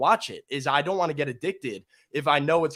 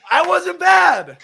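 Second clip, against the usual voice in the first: high, loud, drawn-out self-cheer; elated shout; 12 semitones higher, 10 dB louder, much slower than usual. Real, not acted. Guest after surviving the accent dare, elated shout. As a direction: crow it slowly at full voice.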